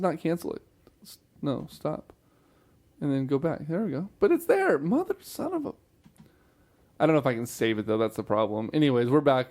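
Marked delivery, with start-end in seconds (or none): 4.2-5.7 much higher, cut-off curse; exasperated high outburst. Real, not acted. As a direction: squeal of frustration. Frustration at lost file.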